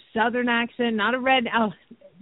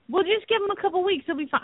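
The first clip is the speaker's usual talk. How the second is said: quick, higher-pitched imitation of a breezy vet; mock dismissive vet; 8 semitones higher, much faster than usual. Vet putting off worried horse owner, mock dismissive. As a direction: toss it off fast and high, imitating the vet. Retold vet brushing her off.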